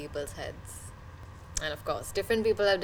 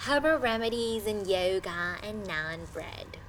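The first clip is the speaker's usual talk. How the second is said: imitated nagging neighbour; slower than usual. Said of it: "She kept nagging my mother".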